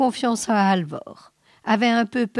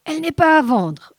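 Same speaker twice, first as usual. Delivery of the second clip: triumphant sneer; 8 semitones higher, 6 dB louder, faster than usual. A narrator's reading. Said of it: "ricana Halvor triomphant".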